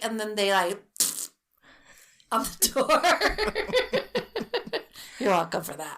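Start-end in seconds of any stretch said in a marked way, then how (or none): 1.5-5.2 high, loud, slow words through laughter; laughing. Real, not acted. Finishing the pigeon poop story, laughing hard.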